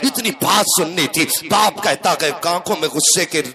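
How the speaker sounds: fervent sermon storytelling, driven on at full force; impassioned preaching. Acted. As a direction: preach the tale with relentless, rousing intensity. (پرجوش خطیبانہ انداز)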